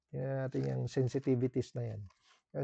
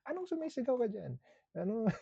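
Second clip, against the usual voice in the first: high-pitched mock disbelief; mock indignant protest; 9 semitones higher than usual. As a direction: protest the accusation in a high, playful retort. Acted reply in texting quarrel.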